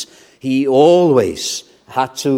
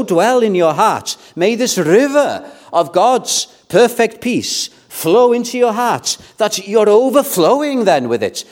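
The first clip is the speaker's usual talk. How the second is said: high, swelling pulpit exhortation; fervent preaching; 8 semitones higher, 4 dB louder than usual. Real, not acted. Preacher wishing peace into his congregation's hearts, fervent preaching. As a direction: lift the voice and pour out the blessing.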